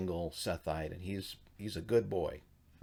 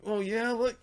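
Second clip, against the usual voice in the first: much higher, louder sneering voice; mocking high taunt; 14 semitones higher, 4 dB louder than usual. Canaanite taunts the Sethite, high mocking sneer.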